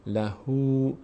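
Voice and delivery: "لهو" drawn out as "la-hoo"; lengthened damma. Shown as a demonstration. Teacher repeats the model for learners, long "oo". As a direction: hold the final "oo" of "لهو".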